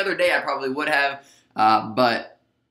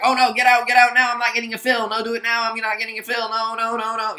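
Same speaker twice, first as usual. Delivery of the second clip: mock panic; 8 semitones higher than usual. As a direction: ham up a panicking trader begging for a fill. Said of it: "they're like".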